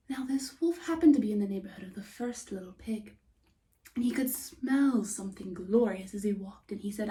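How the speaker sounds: plain reading voice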